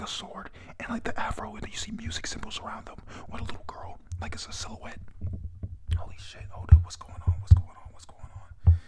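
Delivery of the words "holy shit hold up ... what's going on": urgent, louder whispered disbelief; stunned, forceful stage whisper; startled disbelief. Real, not acted. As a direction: react in shock, repeating it louder. Startled reaction to flashback reveal.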